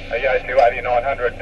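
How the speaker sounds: ordinary delivery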